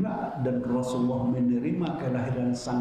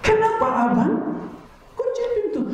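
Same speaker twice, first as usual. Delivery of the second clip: high, loud scolding of her husband; wife scolding her husband for locking her out, high and loud; angry wife; 6 dB louder than usual. Retold or imitated. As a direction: snap at him like a locked-out wife. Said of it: "istri langsung marah katanya".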